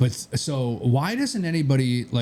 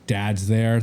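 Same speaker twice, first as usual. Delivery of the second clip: ordinary talk, nothing marked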